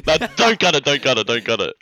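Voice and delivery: loud, high mock plea; playful loud protest. Real, not acted. Pleading not to cut the bit.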